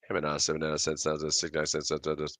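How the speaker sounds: fast auctioneer patter. Acted. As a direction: rattle off prices like an auctioneer. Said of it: "my auctioneer voice".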